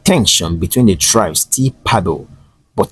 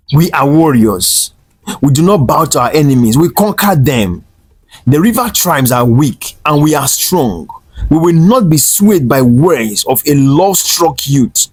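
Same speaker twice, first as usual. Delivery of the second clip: higher, louder warrior boast, slightly hammed-up; cold, proud declaration; 7 semitones higher, 4 dB louder than usual. A narrator's reading. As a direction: proclaim their strength over the boy. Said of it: "his voice cold".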